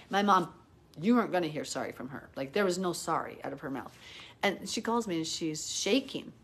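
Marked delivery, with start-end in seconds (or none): none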